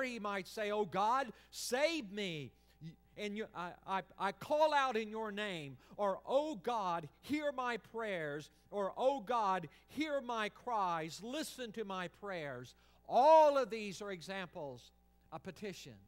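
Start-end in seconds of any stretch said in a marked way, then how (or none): 0.7-5.8 pleading cry. Acted. Psalmist crying out to God. 6.3-8.5 pleading cry. Acted. Psalmist praying aloud. 9.0-12.7 pleading cry. Acted. Psalmist praying aloud.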